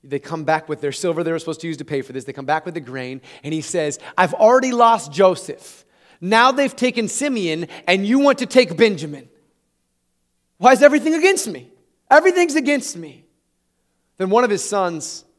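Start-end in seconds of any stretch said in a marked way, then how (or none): 4.2-13.2 loud, higher, anguished cry of a father; distraught lament. Acted. Jacob to his sons, a loud distraught lament. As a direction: wail that everything is against you.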